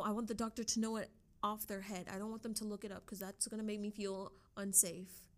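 usual speaking voice

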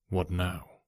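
low, soft hushed question; whispered. A narrator's reading. Holmes whispering to his companions in the empty office. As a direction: keep it low so Pinner won't hear. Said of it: "whispered Holmes".

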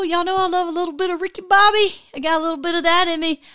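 bubbling over, words tumbling out; pressured rushing speech. Real, not acted. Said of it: "I'm talking a mile a minute".

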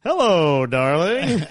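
hammed-up lovey phone greeting; imitated sweet greeting. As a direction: greet the wife with mock sweetness. Father greeting his wife sweetly.

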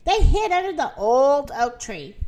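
ordinary delivery, nothing marked

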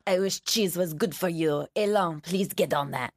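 put-on Peter Sellers kind of French accent; hammed-up French accent for a guessing game.

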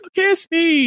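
high-pitched mock plea for affection; mock eager pleading. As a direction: put on a high, needy voice. Mimicking eager rivals in contrast.

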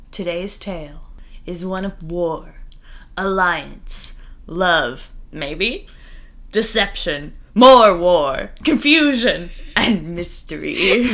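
hushed, grave movie-trailer announcer; serious announcer voice. Acted. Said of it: "serious, serious announcer voice".

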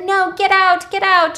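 high, loud acted protest; distressed shout. Claire ordering Virgil away from her, distressed shout.

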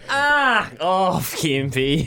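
lightly voiced imitation of a mate; a hint of Clado's voice; Clado impression. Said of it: "as Clado would go".

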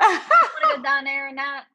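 laughing Pittsburgh accent. Shown as a demonstration. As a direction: ham up the Yinzer Pittsburgh accent. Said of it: "I will give you an accent".

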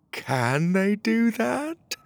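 high, very slow, puzzled guard voice; unsure guard. Acted. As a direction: ask your partner, slow and confused. Guard asking other guard.

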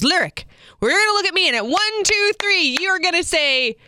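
exaggerated high up-and-down voice; big sing-song photographer. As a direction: imitate the over-the-top photographer coaxing a kid. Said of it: "big up and down in his voice".

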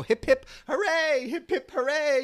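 high, loud toss-in-the-air cheer; playful cheer. Retold or imitated. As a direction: cheer along with a little kid. Cheer game with his daughter.

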